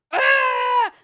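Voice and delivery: put-on piercing high baby shriek; loud imitated baby scream; toddler scream.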